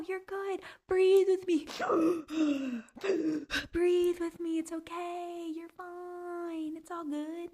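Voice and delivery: calm someone down like a helper; breathe-with-me soothing, high and hushed; mock soothing. Soothing self after TikTok portal.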